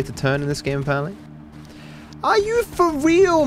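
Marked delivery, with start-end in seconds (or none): 2.2-3.5 very high, slow disbelief; stunned complaint; incredulous. Real, not acted. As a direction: stretch it out in outrage. Outraged at game.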